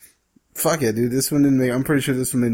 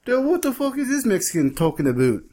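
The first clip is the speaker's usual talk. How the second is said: higher mock Canadian asking what the Mexican means; Canadian listener griping about the Mexican, in a high mock accent; mock Canadian gripe; 4 semitones higher than usual. Mock Canadian complaining about speaker.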